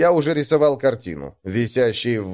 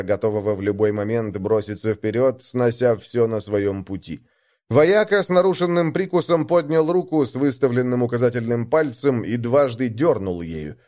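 plain reading voice

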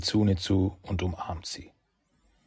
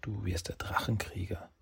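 softer and softer whisper; 9 dB quieter than usual. A narrator's reading. Kitsune whispers Saki's destiny to him. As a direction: fade into a whisper. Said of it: "flüstert ihm zu".

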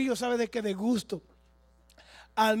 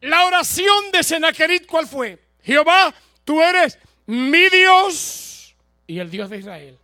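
much louder, slow proclamation; booming preaching; 7 semitones higher, 14 dB louder than usual. Acted. Preacher proclaiming Sennacherib's prayer to the congregation, booming.

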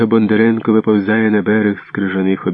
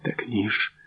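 usual speaking voice

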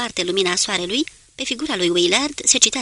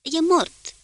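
a little more forceful, high-pitched; firmer repeat; 9 semitones higher than usual. A narrator's reading. Willard insists to the lookout, slightly raising his voice. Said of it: "Și repetă mai tare".

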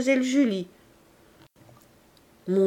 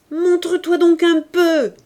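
loud, high furious shout; 8 semitones higher, 9 dB louder than usual. A narrator's reading. Lisa yelling furiously across the courtyard. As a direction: yell it out in a rage. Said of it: "continuait Lisa furieuse".

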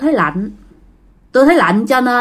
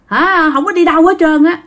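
higher-pitched, emphatic refusal; stubborn refusal; 6 semitones higher than usual. Acted. Grandpa refusing to go to church, stubborn refusal. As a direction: dig in and pitch it up. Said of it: "Thì ông ngoại không chịu".

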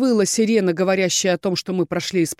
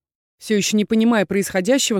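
plain reading voice

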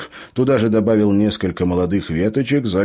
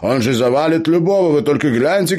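high, loud, enthusiastic gnome voice; excited gnome; 10 semitones higher, 5 dB louder than usual. Acted. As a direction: sell the better plan with high-pitched glee. Gnome enthuses over better plan.

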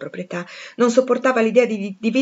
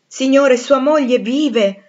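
daring outburst; 5 semitones higher, 7 dB louder, much slower than usual. Acted. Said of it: "eppure osai parlare".

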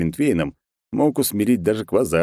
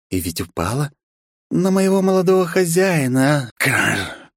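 higher creepy servant voice; eerie maid voice; 8 semitones higher than usual. Acted. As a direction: speak like a watchful owl. Grace's eerie owl-like voice.

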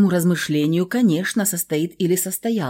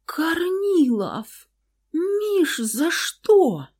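high, breaking, near tears; trembling voice; 12 semitones higher, much slower than usual. Acted. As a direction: voice cracking with hurt. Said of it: "дрогнувшим голосом спросила девушка".

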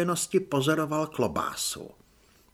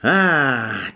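loud, drawn-out stagey sigh; theatrical sigh; 2 semitones lower, 8 dB louder than usual. A narrator's reading. Corporal despairing of his recruits, theatrical sigh. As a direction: heave a big exasperated sigh. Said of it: "si teatrálně povzdechl".

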